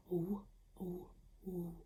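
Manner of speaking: "W, w" as a soft breathy w sound, no vowel after; quiet w sound. Shown as a demonstration. Teacher modelling the quiet w sound for children. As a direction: breathe the "w" softly with rounded lips, no "wuh". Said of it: "It's a very quiet sound".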